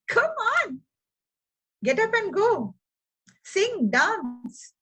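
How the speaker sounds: high, rousing call to get up; urging. Real, not acted. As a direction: push them up and out the door. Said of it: "urging the listener".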